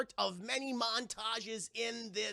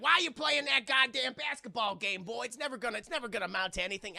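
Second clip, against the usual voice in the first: loud, raised voice of a scolding dad; gruff dad; 4 semitones higher, 4 dB louder than usual. Retold or imitated. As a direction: bark at the boy like an angry father. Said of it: "his dad's like".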